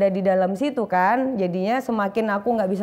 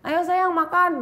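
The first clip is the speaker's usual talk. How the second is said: higher sweet coaxing; tender mother; 7 semitones higher, slower than usual. Retold or imitated. Mother coaxing her daughter to eat, higher and sweet. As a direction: coax like a loving mom.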